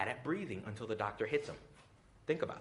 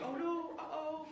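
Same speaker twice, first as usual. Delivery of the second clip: high, slow uh-oh; playful high-pitched parent voice; mock baby-soothing concern; slower than usual. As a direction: coo over a toddler's tumble. Reacting to a toddler falling.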